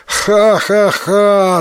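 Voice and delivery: high, slow acted ha-ha-ha; storyteller's spelt-out loud-hearted laugh; hearty guffaw. A narrator's reading. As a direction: laugh out triumphantly in measured syllables. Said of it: "захохотал Тикуротаро".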